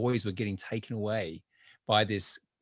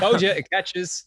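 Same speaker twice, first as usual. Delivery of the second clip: laughing tease; 10 semitones higher, 8 dB louder, slower than usual. Real, not acted. Laughing banter about catching accent.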